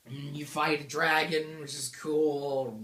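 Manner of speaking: usual speaking voice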